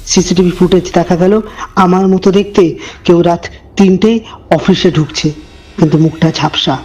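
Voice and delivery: low, hushed storyteller voice; eerie narration, measured and dark; horror story narration. A narrator's reading. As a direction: draw the listener into a creepy tale.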